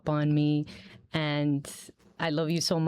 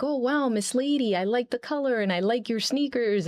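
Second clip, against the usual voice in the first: put-on excited kid; 6 semitones higher than usual. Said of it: "and they're like".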